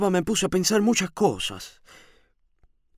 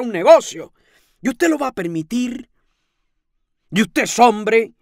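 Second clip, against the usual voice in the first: indignant challenge; 5 semitones higher, 5 dB louder than usual. A narrator's reading. Landlady shaming the lodger, indignantly. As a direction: challenge him with outrage.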